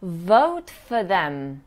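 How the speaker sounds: Vote for them slowly, for with a short weak vowel; patient teacher model; slow demo. Shown as a demonstration. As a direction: draw out vote, keep for light. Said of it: "There's no for".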